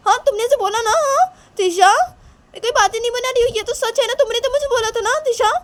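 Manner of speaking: put-on very high, loud child voice; upset accusing girl. Shanaya confronts Tisha over her denial, upset. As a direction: argue back frantically in a squeaky voice.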